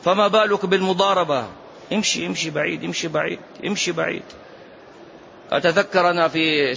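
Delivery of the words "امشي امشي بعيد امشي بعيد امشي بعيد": softer, repeated brush-off; quiet dismissal. Acted. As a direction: wave them away under your voice. Softly waving off someone looking to fight. (صرف بصوت خافت)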